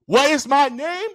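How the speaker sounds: drawn-out mock-strict question; commanding teacher. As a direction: imitate a teacher quizzing from atop the desk. Teacher quizzing class from desk.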